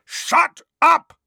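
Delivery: furious high shout; roaring. Acted. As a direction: roar it at full blast. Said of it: "roared Uncle Vernon and Aunt Petunia".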